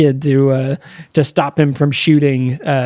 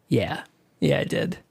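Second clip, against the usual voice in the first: much softer, fading away; soft admission; 10 dB quieter than usual. Retold or imitated. John quietly admitting to Kelly he gave the money back. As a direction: confess it gently, almost under breath.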